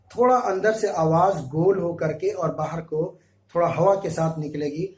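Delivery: ordinary talk, nothing marked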